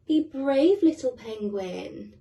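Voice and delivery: usual speaking voice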